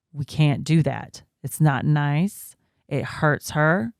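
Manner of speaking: slow, measured retold reprimand; patient firm correction. Mother gets through to biting toddler, slowly firm. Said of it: "continued that line of talking to her".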